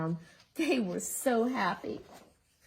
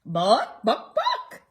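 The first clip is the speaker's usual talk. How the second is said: clucking hen; 6 dB louder, slower than usual. Acted.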